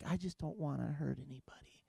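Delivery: very soft, meek little murmur; timid. Retold or imitated. Dad afraid to discipline his kids, timid murmur. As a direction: shrink the voice almost to nothing. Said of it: "I'm afraid to".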